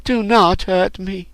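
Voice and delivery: put-on high, pleading girl's voice; high begging voice, slowing; crying plea. A narrator's reading. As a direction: beg desperately, pitched high. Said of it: "cried the Hinrabian".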